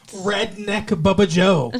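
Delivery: slow Kentucky drawl. Shown as a demonstration. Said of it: "that's like a Kentucky voice".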